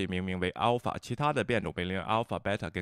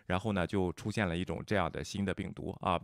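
usual speaking voice

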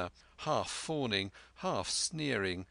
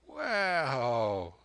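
put-on sly old pirate; 3 semitones higher, 3 dB louder than usual.

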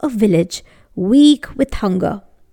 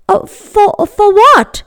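startled; 10 semitones higher, 5 dB louder than usual. Acted. Startled poor man.

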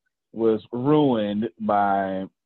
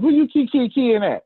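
much higher, loud mock confrontation; Southern woman challenging; 10 semitones higher, 4 dB louder than usual. Host imitating a suspicious Southern partner, high challenging voice. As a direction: imitate her calling you out, pitched way up. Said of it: "that's how they say it down here".

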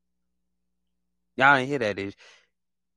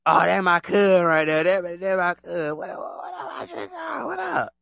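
hyper hype-man; 3 semitones higher than usual. Retold or imitated. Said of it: "people that be like".

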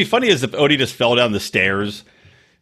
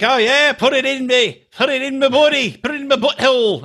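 high-pitched, badly faked Australian woman; mock Australian accent; 11 semitones higher than usual. Australian woman urging her hookup on, mimicked in a high fake Australian accent. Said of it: "she was Australian".

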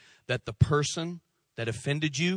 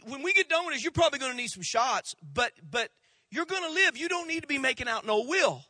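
higher, friendly doctor voice; doctor reassuring the bitten man, higher voice; 8 semitones higher, faster than usual. Retold or imitated. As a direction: reassure the patient, lightly voiced. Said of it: "He said".

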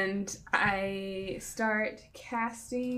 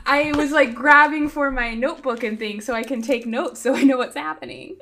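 laughing explanation; 8 dB louder than usual. Real, not acted. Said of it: "sounded like you dropped everything".

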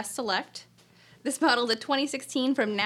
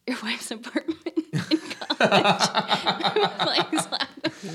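giggling through the words; breathy laugh while talking; laughing whisper; 3 semitones higher, 4 dB louder, much slower than usual. Real, not acted. Laughing while telling college story.

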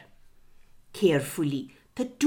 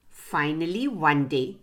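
ordinary delivery

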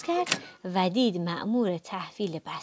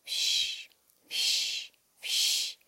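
hushed "whoosh" of dusting, repeated; imitated sweeping noise; 5 dB quieter, much slower than usual. A narrator's reading. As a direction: make soft, airy swishes like a duster. Sound effects of dusting in story.

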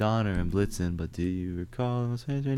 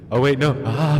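mock falling; 5 semitones higher, 6 dB louder than usual. Pretend falling down well.

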